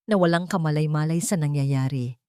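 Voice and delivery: ordinary delivery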